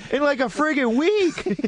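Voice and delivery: high whining plea to leave him; pleading, put-on girlfriend voice; mock whiny girlfriend voice. Friend mocks her ten-year relationship in a high whining voice.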